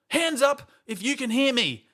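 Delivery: high, loud strict teacher voice; imitating a strict teacher. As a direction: call the class to order. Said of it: "The teacher was quite strict".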